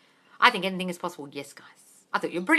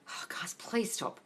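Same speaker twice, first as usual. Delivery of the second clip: whispered plea; 4 semitones higher, 6 dB quieter, much slower than usual. Real, not acted. Exasperated plea to viewers.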